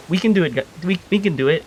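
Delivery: high, mildly tearful pep talk to oneself; tearful self-consoling. Acted. Character consoling himself after failing to save people, mildly tearful. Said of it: "keeps repeating that over and over while crying".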